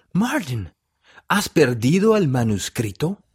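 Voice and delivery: high, urgent, dreading question; alarmed question. A narrator's reading. José leaping up to question Martin, alarmed. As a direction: call his name high, then demand in alarm.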